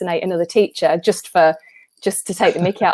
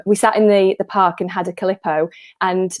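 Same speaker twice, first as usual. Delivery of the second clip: ordinary talk, nothing marked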